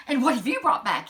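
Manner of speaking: the king turns to the prince expectantly; king's question. Acted. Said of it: "he said".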